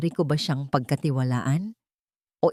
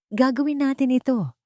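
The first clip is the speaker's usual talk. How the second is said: decisive, a touch higher; firm resolve; 8 semitones higher than usual. A narrator's reading. Kalista deciding with her father, firm and resolved. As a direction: settle it firmly. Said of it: "Ang aking desisyon ay buo".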